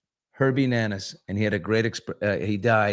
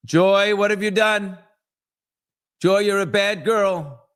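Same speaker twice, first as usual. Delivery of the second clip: mock scolding; 10 semitones higher, 3 dB louder than usual. Telling Joy she is a bad girl, mock scolding. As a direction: scold her in a high, teasing voice.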